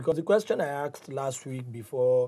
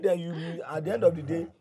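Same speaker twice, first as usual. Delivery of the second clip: ordinary delivery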